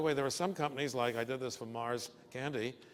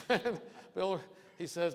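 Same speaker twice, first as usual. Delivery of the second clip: laughing through words; 7 semitones higher than usual. Real, not acted. Laughs while telling.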